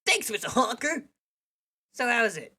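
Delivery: high, bright chirpy voice; perky character voice. Acted. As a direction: chirp it cheerfully.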